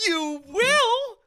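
very high, loud squeal of disbelief; thrilled surprise. Retold or imitated. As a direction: squeak it out, overjoyed and amazed. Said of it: "And I was like".

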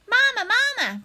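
put-on high, loud baby-bird cry; high-pitched little gosling voice; peeping gosling. A narrator's reading. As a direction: call out like a tiny, insistent hatchling. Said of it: "peeped the gosling".

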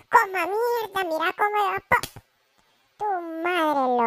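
gleeful cry watching the train smash; excited yell. Acted. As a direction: cheer the crash like a fan. Cheering a train crash.

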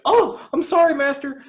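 high, loud squealing cry of pain; mock pained yelp. Mocking Sean Spears reacting to the slap, high squealing yelp. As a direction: yelp like a slapped servant. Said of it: "Sean Spears went".

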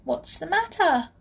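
high little pixie voice; tiny voice. A narrator's reading. Pixie asks crying Molly, tiny voice. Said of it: "A tiny voice called to her".

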